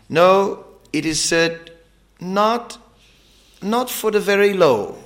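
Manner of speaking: high, ringing preacher's climax; preaching. Acted. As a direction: build it up like a fiery sermon.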